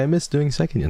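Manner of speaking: high, sweet quoted voice; cute imitation. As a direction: mimic a friend being adorable. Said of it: "It was so cute".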